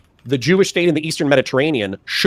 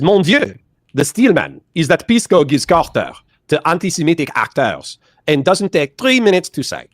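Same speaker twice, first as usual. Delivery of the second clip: a put-on French accent, higher and louder, exclaiming; hammed-up French accent, exclaiming; mock French accent; 4 semitones higher, 3 dB louder than usual. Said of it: "I'll say it with fewer words in his exact phrasing".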